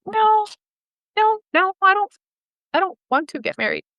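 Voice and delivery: high, pleading-sounding retold no; retold refusal. Refusing her boyfriend's marriage proposal, high-pitched protest. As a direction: push the no up high, then ease down.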